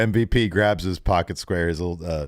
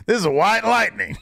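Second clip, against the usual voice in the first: laughing through it; 14 semitones higher, 3 dB louder than usual. Real, not acted. Laughing while quoting Brock.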